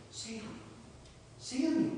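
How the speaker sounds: high, hushed calling of a name; soft mysterious call. Acted. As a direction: call the name softly from afar. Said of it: "he hears this voice again".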